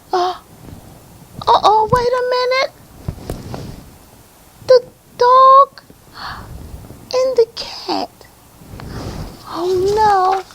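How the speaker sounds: high-pitched playful dismay; mock alarm. Reader discovering the dog meowing, mock alarm. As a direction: gasp and squeak at the discovery. Reader reacts to animal mix-up.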